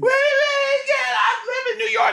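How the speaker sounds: high-pitched, worked-up disbelief; shocked outburst. Real, not acted. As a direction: exclaim high in alarm at what you saw. Shocked reaction to gruesome video.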